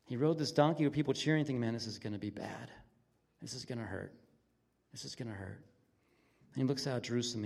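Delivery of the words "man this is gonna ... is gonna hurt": low, quiet thought to oneself; understated, lowered voice of resignation; inward dread. Acted. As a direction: sink softly into mild dread. Voices Jesus's private dread.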